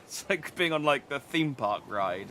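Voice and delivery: normal talking voice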